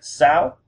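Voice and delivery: "Sao" climbing high at the end; rising tone. Shown as a demonstration. Teacher stressing number six, "Sao" with rising tone. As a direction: lift the pitch right through "Sao". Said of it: "It's really important that you get that right".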